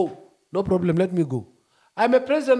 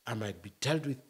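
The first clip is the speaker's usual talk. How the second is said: much softer and lower, timid; scared, careful; 9 semitones lower, 13 dB quieter than usual. Shown as a demonstration. Showing how the scared talk, timid and soft. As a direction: speak as if afraid. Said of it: "you can't speak like this when you are scared".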